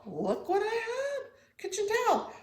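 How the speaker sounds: high, light sing-song showing off the towel; high cutesy voice on the towel; playful squeaky voice. Acted. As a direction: squeak it out like a proud little kid. Playful aside about the towel.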